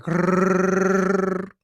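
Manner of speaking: a long loud rolled rrrr; a loud rolled growl of an R. Retold or imitated. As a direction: roll the R like an angry Wookiee. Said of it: "he rolls his R's like".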